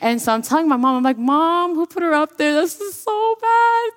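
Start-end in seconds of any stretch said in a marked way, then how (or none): 1.2-3.9 much higher, slow strained complaint; hammed-up horrified child; mock outraged kid. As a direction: squeak it out in disbelief to mom. Child whispering complaint in church.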